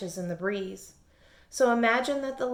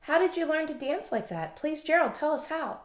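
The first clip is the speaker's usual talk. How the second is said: higher, eager begging; higher eager pleading; 7 semitones higher than usual. A narrator's reading. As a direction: plead keenly for his secret. Animals pleading with Gerald.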